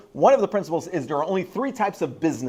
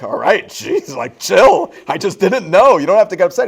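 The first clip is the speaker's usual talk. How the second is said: defensive; 5 semitones higher, 9 dB louder than usual. Retold or imitated. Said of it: "I'm like".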